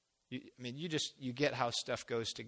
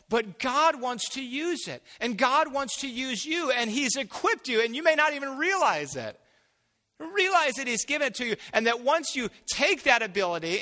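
fervent preaching; 8 semitones higher, 8 dB louder than usual. Acted. Preaching at full force.

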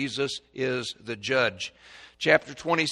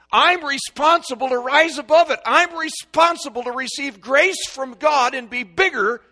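building from raised to much higher and louder; preaching climax; 8 semitones higher, 6 dB louder than usual. Real, not acted. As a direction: drive it up to a shouted climax.

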